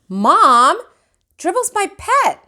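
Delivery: high indignant whine; object, outraged; protesting. A narrator's reading. Peter protesting to mom about his pet, high indignant whine.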